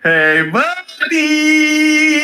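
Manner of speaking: very drawn-out, high caricature; mock chummy catchphrase. Co-host hams up the manager's greeting, mock chummy. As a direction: stretch each word into a high sustained call.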